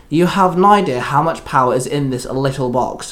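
ordinary delivery

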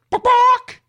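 short, high, loud yapping bark; mock dog bark. Host mocking printed chicken with a high, loud bark. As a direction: yap it out like a little dog.

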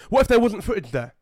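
ordinary talk

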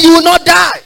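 high and loud, hammered out; forceful assurance. Real, not acted. Preacher shouting assurance to the sick in the congregation. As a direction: shout the promise over them. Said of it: "I said".

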